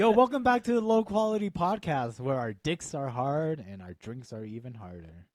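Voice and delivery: loud upbeat host opener; podcast intro. Acted. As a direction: announce the show like a host. Podcast opening greeting.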